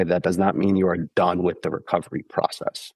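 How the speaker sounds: ordinary talk, nothing marked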